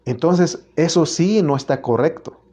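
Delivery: usual speaking voice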